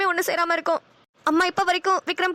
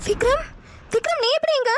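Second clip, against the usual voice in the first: startled, pitched higher, calling his name; surprised; 7 semitones higher than usual. Acted. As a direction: caught off guard at seeing him. Boyfriend appears unexpectedly. (ஆச்சரியத்துடன் உயர்ந்த குரலில்)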